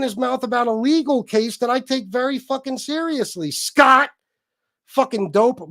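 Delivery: loud, high furious rant at a viewer; angry rant. Real, not acted. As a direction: tear into him, loud and high. Ranting at a chat member.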